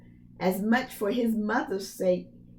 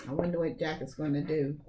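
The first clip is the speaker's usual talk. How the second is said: hushed aside; 5 semitones lower, 6 dB quieter, much faster than usual. Real, not acted. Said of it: "aside to the listener".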